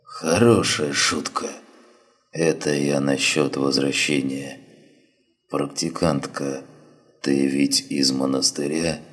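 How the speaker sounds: weak, slow, faint dying voice; dying wounded man. Acted. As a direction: barely get the words out, fading.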